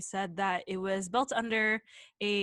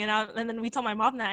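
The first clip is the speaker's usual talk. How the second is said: ordinary delivery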